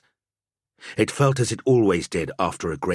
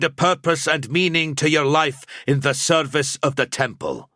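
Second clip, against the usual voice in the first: higher, louder solemn rite; sober priestly pronouncement; 9 semitones higher, 4 dB louder than usual. Acted. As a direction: intone it like a temple priest. Gantz continues the rite.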